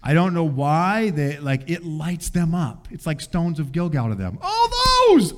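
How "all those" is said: much higher, louder gleeful whoop; kids' excited yell. Retold or imitated. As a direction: mimic kids shrieking with joy. Kids treating hotel as playground.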